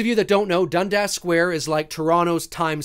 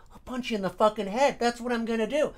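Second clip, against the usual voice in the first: softer, held-back replay of an angry threat; hushed mock threat; 2 semitones higher, 7 dB quieter than usual. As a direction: menace him under your voice. Replays his threat as imagined scene.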